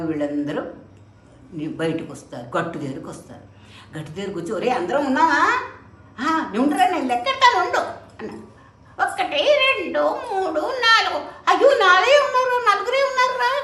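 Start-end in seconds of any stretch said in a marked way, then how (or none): 4.5-13.5 put-on much higher, louder boy's voice, rising into alarm; a small disciple counting in rising alarm; panicky disciple counting. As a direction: count the heads, then panic that one is gone. Disciple counts, finds one missing.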